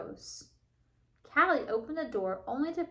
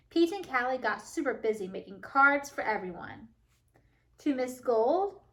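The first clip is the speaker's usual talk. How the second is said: ordinary talk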